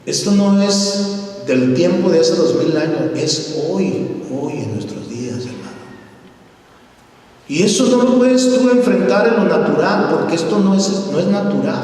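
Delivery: high, insistent preacher's cry; fervent sermon climax; preaching at full force. Acted. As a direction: drive it home to the congregation.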